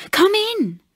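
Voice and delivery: put-on high, eager call of a young girl; excited young girl crying come in. A narrator's reading. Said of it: "the younger of them cried".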